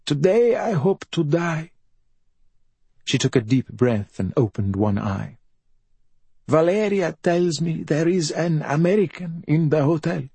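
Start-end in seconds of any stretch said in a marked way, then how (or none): 0.0-1.7 high, self-pitying bedridden mother; high-pitched raspy invalid voice; rasping mother. A narrator's reading. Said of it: "she rasped". 6.5-10.2 high, self-pitying bedridden mother; high-pitched raspy invalid voice; rasping mother. A narrator's reading. Bedridden mother speaking to her son.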